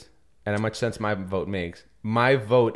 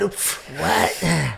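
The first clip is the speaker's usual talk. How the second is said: mock old smoker; 6 semitones higher than usual. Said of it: "He said".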